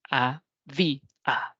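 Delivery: «A, vi, a», había broken into three separate beats; sílabas separadas. Shown as a demonstration. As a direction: pause after each syllable of «A, vi, a». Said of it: "la pronunciamos en dos emisiones de voces". («A, vi, a», había sílaba por sílaba con pausas)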